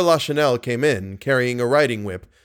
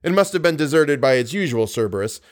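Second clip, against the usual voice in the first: ordinary delivery, nothing marked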